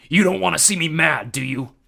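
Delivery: loud threatening war god; menacing. A narrator's reading. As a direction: threaten your daughter. Ares threatening.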